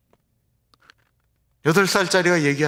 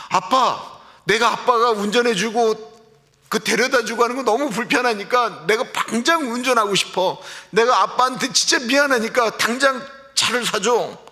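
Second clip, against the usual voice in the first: put-on high, pleading little-kid voice, 그냥 raised well up; whiny child; 9 semitones higher than usual. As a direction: beg like an eight-year-old wanting a car now. Child begs dad for a car. (아빠에게 차를 사달라고 조르는 아이 목소리)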